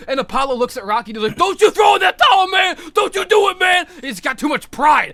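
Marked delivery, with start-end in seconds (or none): 1.4-3.9 imitating a loud, higher defiant yell; proud shout. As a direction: forbid it with warrior pride. Proud fighter shouts at friend.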